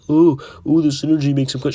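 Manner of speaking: hammed-up mockery of players who defend the synergy; mocking imitation. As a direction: parrot the fans' silly claim. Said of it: "people are like".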